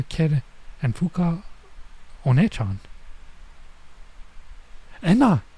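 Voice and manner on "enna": high, loud call of a name. Retold or imitated. Girl calling her friend by name, loud. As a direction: shout Enna across the yard.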